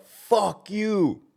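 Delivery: high, drawn-out jeer; mock yell from across a room; retold heckler yell. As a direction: call it out like a heckler. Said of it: "some motherfucker yelled".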